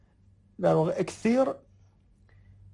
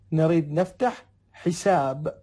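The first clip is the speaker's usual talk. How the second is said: slow demo; slower than usual. Shown as a demonstration. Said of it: "teacher models Arabic phrase".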